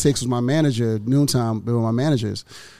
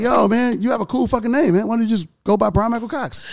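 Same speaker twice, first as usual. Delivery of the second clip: higher, hyped-up voice of a mentor; imitating Noonie; 9 semitones higher than usual. Mentor Noonie hyping up his name, lightly voiced. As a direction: pump him up like an excited mentor. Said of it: "And Noon was like".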